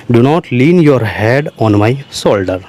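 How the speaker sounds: each word spaced out slowly for the class; slow dictation. Shown as a demonstration. Teacher spelling out an English sentence for learners, slow and deliberate. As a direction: dictate it slowly so students can follow. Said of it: "slow dictation of English phrase".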